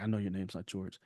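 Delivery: soft secret whisper, leaning in. Retold or imitated. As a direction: hush it like a secret for a fan. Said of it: "He, like, whispered it".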